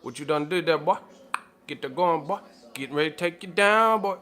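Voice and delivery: imitating a sassy Southern manager warning a crackhead.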